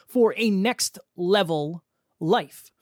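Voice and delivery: announcer. Acted. Live show title announcement.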